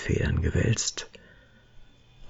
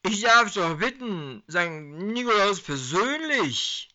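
tipsy protest; 12 semitones higher than usual. Acted. Drunk Herbie protests.

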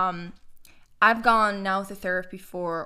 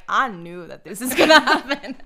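louder, laughing imitation of someone knowing; laughing quote; 2 semitones higher, 8 dB louder than usual. Client teasingly quoting her therapist, laughing as she imitates her.

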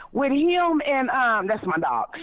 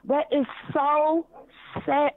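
ordinary delivery

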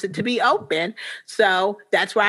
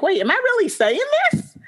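mock disbelief; 13 semitones higher than usual. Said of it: "And I'm like".

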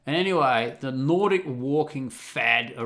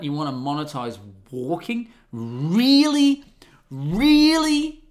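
mock disbelief; 3 semitones higher than usual. Mocking the rafting boss over paid walking, hammed-up disbelief. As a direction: scoff at the idea like it's absurd. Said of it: "I didn't say it like that".

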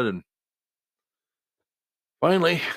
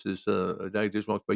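ordinary talk

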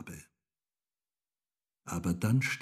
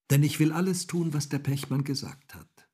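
put-on eager girl; 7 semitones higher, 5 dB louder, faster than usual. A narrator's reading. Girl insists on helping.

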